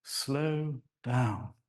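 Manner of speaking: slow, lengthened vowels; elongated. Shown as a demonstration. Speaker showing elongation, slow and drawn out. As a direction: draw out slow and down.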